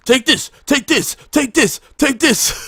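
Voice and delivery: high, gleeful taunting voice; mock an eager player tossing cards; taunting spammer. Mocking aggro players dumping cards.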